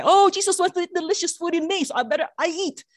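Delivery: high, eager mock excuse; playful self-justifying. Speaker justifies eating something delicious to herself, playfully self-justifying. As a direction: pitch it up as a comic excuse for indulging. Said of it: "I like to justify this myself".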